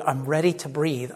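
hushed, fast self-soothing; calm. Retold or imitated. Holding his breath underwater, calming himself. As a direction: talk yourself into staying calm. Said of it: "you have to be calm".

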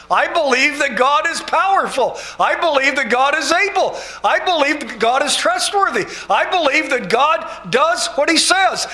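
loud, high, ringing declaration of faith; impassioned pulpit cry; full-force preaching. Acted.

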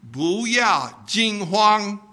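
"Bu yao jing huang" said slowly in Mandarin; Chinese phrase. Shown as a demonstration. Teaching the congregation Mandarin, slow careful phrase. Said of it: "The Chinese Bible ... says".

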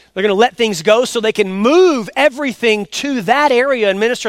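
loud, driving preacher voice; preaching. Acted. Pastor preaching to his church, loud and driving. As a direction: preach it out to the congregation.

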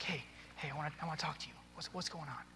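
very soft, coaxing voice; gentle, confiding. Shown as a demonstration. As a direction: kneel by a child and quietly draw them out. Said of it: "if you kneel down and you're like".

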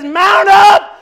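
Jesus rallying heaven, loud command; commanding shout. Acted. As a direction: call the army to ride out.

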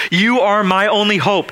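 a mildly acted desperate beg; pleading. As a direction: plead as if he's your only hope. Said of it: "He was pleading with Jesus Christ".

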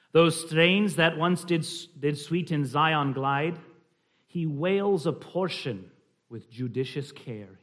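slow, measured Scottish recitation; slight Scottish accent. Shown as a demonstration. Reading of Zion's old strains, slight Scottish accent. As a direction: read the verse in gentle Scots. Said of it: "a slight Scottish accent".

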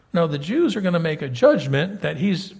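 ordinary delivery

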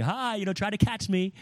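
a light, high, teasing call, mock-playful. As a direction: tease like a parent playing tag. God teasing as if playing tag.

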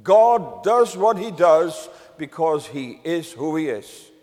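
ordinary talk, nothing marked

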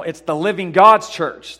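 "living God's" punched out loud; loud, deliberate emphasis on "living God's"; stress on living God. Shown as a demonstration. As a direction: lean hard and slowly on "living God's". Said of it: "the emphasis on the living God".

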